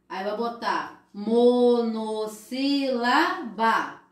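slow, drawn-out monossílaba; slow teacher's voice naming monossílaba; slow teaching model. Shown as a demonstration. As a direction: spell it out slowly for young pupils. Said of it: "Ah, tem uma sílaba".

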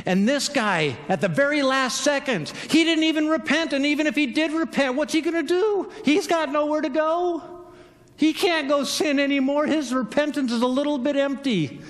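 high-pitched, baffled complaint; incredulous protest. Acted. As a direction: argue that it's unfair. Acted protest continues.

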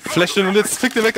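high, urgent shout; hyped callout. Real, not acted. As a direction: urge the kill hard. Urging teammate in fight.

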